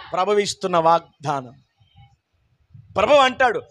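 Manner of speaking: normal talking voice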